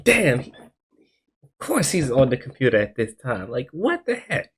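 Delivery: high, slow mock-frustrated voice; exasperated. Caught sneaking out by brother, high exasperated whine.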